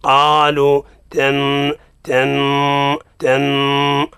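slow repeated nasal "تن" after "بقرة"; ghunna drill. Shown as a demonstration. Teacher drilling the ghunna syllable slowly. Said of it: "نتدرج باش نستعد إلى مخرج القاف".

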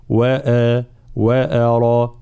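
وأرى with a thin hamza before the heavy ra; careful slow recited وأرى; moderately light hamza. Shown as a demonstration. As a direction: say وأرى with a light but unexaggerated a. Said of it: "ترقيق الهمزة بلا مبالغة".

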